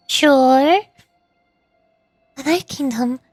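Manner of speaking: ordinary talk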